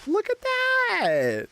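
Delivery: very high, very slow, held in wonder; awestruck. Real, not acted. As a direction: stretch it out in pure amazement. Sees the mailbox in awe.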